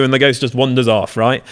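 ordinary talk

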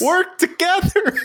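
very high-pitched holler breaking into a laugh; exasperated, laughing screen-yell; mock yell. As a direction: plead with the movie characters in a high yell. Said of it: "were yelling at the screen".

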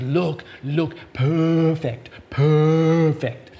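put-on Korean-Australian accent, beaming with pride; bad Korean-Australian accent. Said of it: "a very bad Korean, Australian accent coming".

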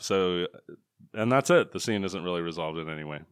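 normal talking voice

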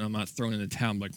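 ordinary talk

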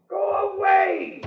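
high, slow, called-out shout; drawn-out shout. Acted. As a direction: stretch it out as a shout. Old man shooing a visitor.

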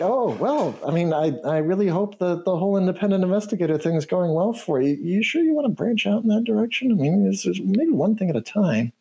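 high, hesitant, politely doubtful; high canary, a little taken aback. Acted. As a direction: voice her doubt while staying polite. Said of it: "she looks a little taken aback".

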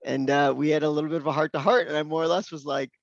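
usual speaking voice

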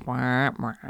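wah wah sung low and soft; deflating sad trombone sound; mock sad trombone.

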